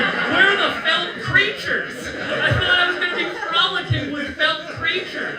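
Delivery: high, loud mock complaint; distressed outcry. Acting out a disappointed mushroom user.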